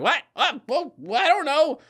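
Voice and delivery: high, baffled stammer; flustered mom. Retold or imitated. Mom asked for the missing fingertip, flustered and baffled. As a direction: play the mother caught off guard. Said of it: "She's like".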